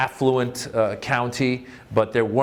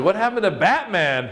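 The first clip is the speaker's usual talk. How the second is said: dismayed; 8 semitones higher than usual. Retold or imitated. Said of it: "I was like".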